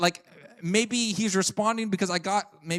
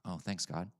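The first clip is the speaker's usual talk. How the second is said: sarcastic thanks; 12 semitones lower, 12 dB quieter than usual. Retold or imitated. Sarcastic response to bad news.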